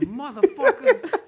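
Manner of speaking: a high, loud, slow curse; angry outburst. Retold or imitated. A comic's impression of the offended comedian, shouted high and slow. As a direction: explode at the sweaty handshake. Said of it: "He said".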